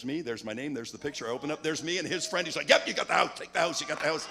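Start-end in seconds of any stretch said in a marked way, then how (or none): 2.7-4.3 loud, delighted retelling of the host; host giving in, loud and thrilled; excited concession. Retold or imitated. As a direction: burst out gladly: you got it.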